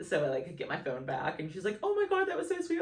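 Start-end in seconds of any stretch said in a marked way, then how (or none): 1.8-2.8 loud, high-pitched squeal; imitating an emotional gush; gushing therapist. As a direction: squeal it, touched to the point of tears.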